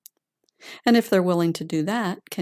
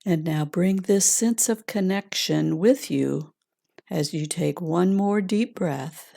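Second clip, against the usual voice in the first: calm grounding exercise; 3 dB quieter than usual. Acted. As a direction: gently guide them inward, unhurried. Leads a heart meditation.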